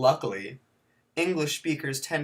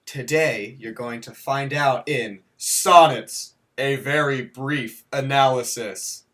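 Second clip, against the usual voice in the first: loud announcer voice; big intro title announcement; announcer title read; 7 dB louder than usual. Acted. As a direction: proclaim the title like a show host.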